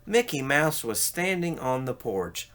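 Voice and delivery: ordinary delivery, nothing marked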